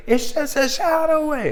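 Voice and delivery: mock high cracking singer line. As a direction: squeak it up high like her voice cracks. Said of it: "her voice cracks".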